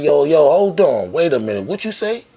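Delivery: loud, high call to stop him; mock shout at the door. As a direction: stop him before he leaves. Stops boss at the door.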